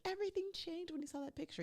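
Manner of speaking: high, fretful imitation of a clingy fiancée; mock anxious Chelsea. Mimicking Chelsea's complaint.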